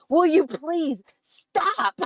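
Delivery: high, slow, drawn-out plea; exasperated husband. Retold or imitated. Husband pleading with howling wife and dog, exasperated. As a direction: beg them to stop, word by word. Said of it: "Jason comes out, and he's like".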